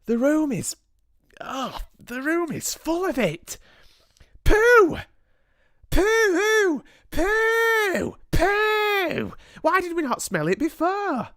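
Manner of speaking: very high, loud disgusted squeals; squealing witches. A narrator's reading. Witches are overwhelmed by the smell, high squealing disgust. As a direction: wail it high, recoiling from a stench.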